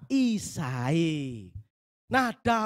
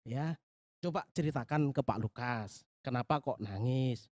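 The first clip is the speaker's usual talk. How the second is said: ordinary talk, nothing marked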